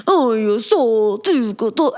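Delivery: slow, drawn-out mock Italian accent; hammed-up Italian accent. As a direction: stretch it out in a put-on Italian accent. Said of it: "It's Italian".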